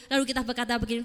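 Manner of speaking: ordinary talk, nothing marked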